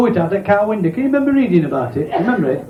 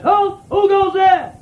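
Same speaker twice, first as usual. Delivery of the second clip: sentry shout; 10 semitones higher, slower than usual. Acted.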